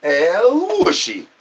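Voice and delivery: slow, stretched El Whooshy call; drawn-out catchphrase. Pretend. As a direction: stretch it out like a showman. Repeated catchphrase bit.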